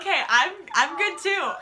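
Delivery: loud, high burst, breaking into laughter; excited reply. Retold or imitated. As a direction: blurt it out loudly, hammed-up. Acting out own flustered reply.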